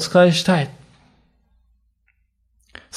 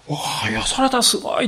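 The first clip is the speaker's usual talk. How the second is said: delighted surprise; 8 semitones higher, 5 dB quieter than usual. Acted. Lepers discover they are healed.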